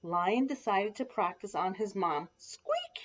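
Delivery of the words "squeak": very high little squeak; tiny squeak. A narrator's reading. Little Lion pounces on his mom, high squeak. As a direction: squeak like a small cub. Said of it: "he said, pouncing on her".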